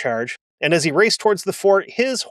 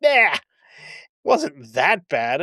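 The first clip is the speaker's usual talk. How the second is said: mocking dismissal; slower than usual. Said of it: "And he's just like".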